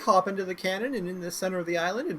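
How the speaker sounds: loud, gleeful clown voice; a giddy carnival clown, overjoyed; silly clown. Acted.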